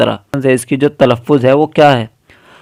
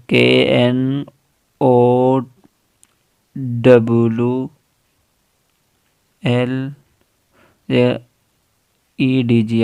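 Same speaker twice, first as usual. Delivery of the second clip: slow spelling out; much slower than usual. Shown as a demonstration. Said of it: "जैसे कि knowledge".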